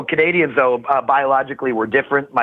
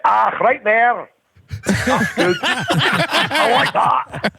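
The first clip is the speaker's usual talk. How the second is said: slow, higher mock Scottish accent; a light Scottish purr, drawn out; a Scottish girl in bed, lightly voiced Scottish accent; 7 semitones higher, much slower than usual. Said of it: "with that thick Scottish accent".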